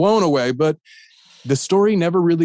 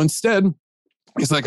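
ordinary talk

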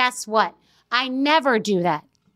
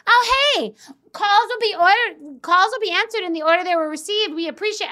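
higher, bright mock hold-message voice; sing-song customer service chirp; mock hold recording; 7 semitones higher, 5 dB louder than usual. Verizon hold line answering her call, mock chirpy recording.

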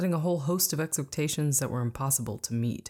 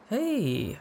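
high excited greeting; bright forced hey; exclaimed; 8 semitones higher than usual. A narrator's reading. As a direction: sell a cheerful hello over panic. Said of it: "I exclaimed".

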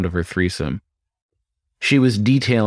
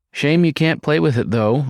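coy; 6 semitones higher than usual. Acted. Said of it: "I stated coyly".

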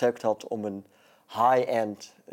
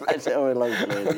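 laughing; faster than usual. Real, not acted. Repeats it laughing.

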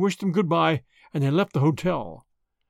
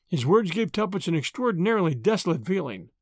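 plain reading voice

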